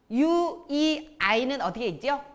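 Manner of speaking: normal talking voice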